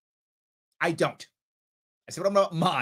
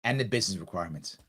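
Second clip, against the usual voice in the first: sarcastic echo; 10 semitones lower, 7 dB quieter, faster than usual. Retold or imitated.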